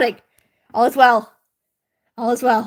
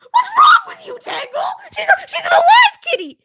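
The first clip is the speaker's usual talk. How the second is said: outraged shriek. Real, not acted. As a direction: shriek at the chat for calling the cat dead. Defending cat against chat.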